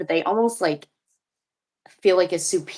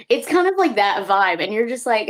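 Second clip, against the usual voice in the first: ordinary talk, nothing marked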